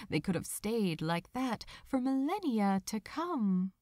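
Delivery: ordinary delivery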